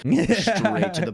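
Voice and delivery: excited. Retold or imitated. Excited hammed-up exclamation.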